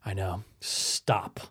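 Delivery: whispered stop. Real, not acted. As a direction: hush him under your breath. Embarrassed aside to co-host.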